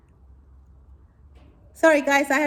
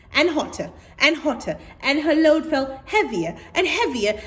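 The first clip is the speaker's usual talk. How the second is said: reading faster and rising, with a laugh; building story reading; 7 semitones higher, 3 dB louder than usual. A narrator's reading. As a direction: let the repetition build up.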